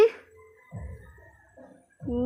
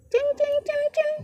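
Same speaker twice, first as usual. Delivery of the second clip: high calling of the pet's name; 13 semitones higher than usual. Real, not acted. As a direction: chirp the name to call the pet. Calling the pet.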